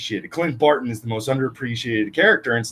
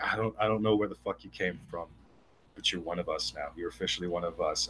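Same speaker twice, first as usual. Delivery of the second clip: low, much softer mock-solemn voice; hushed acceptance; 6 semitones lower, 14 dB quieter, faster than usual. Fans welcoming a woman as one of them, hushed and low. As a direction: drop to a hushed, awed undertone.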